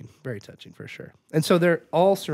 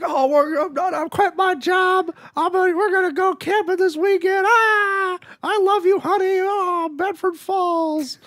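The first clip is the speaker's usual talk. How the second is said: very high, gushing parody; mock giddy joy. Hero back home with his family, hammed-up giddy joy. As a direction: squeal with over-the-top delight.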